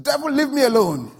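loud, high acted yell; indignant yell. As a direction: yell to drive off a pest. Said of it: "he yelled again".

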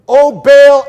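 high, loud cry to Baal; shouting. Acted. Priests of Baal crying to their god, shouting. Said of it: "they shouted".

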